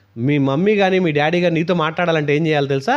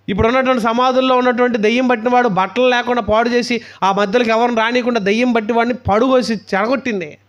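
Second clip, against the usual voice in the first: preaching at full force; 8 semitones higher, 3 dB louder than usual. Acted. Preacher warning the youth about the devil, forceful sermon. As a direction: thunder it at the congregation.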